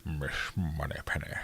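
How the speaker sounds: soft, low-key Bond impression; Sean Connery. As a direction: murmur it like the famous Scottish Bond. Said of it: "I should do that Sean Connery accent more often".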